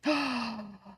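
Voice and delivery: long gasp of surprise; amazed gasp. Real, not acted. Streamer sees the trim worked, amazed gasp. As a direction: gasp in disbelief. Tool unexpectedly works.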